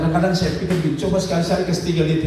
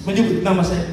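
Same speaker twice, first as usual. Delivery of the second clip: normal talking voice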